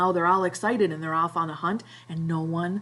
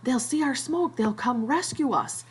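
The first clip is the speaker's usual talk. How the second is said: much higher, eager hope; the boys' rush of rescue joy, voiced by the teacher; excited; 7 semitones higher than usual. Boys spotting a ship, high excited retold cry. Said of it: "they're all excited".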